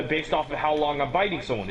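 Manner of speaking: ordinary talk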